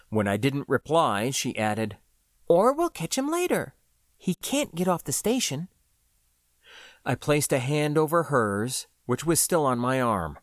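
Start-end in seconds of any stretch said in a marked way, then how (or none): 2.5-5.7 higher female voice, firm; cautious partner holding him back; Floy's voice. Acted. Floy voiced by the narrator.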